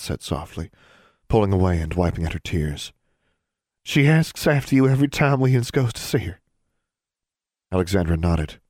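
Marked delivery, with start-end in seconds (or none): 3.8-6.4 high lightly voiced young girl. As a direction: speak up as an eager young girl.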